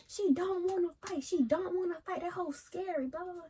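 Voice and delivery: high-pitched mad taunting; retold yelling. Girl pulled away by boyfriend, still yelling about her rival, high-pitched. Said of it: "And I was just yelling".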